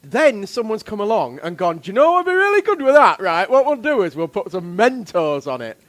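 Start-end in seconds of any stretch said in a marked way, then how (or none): 1.8-5.8 mock inventor voice. Voicing the imagined inventor.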